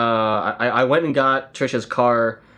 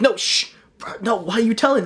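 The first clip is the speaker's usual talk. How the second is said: embarrassed customer; 10 semitones higher than usual. Pretend. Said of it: "And you're like".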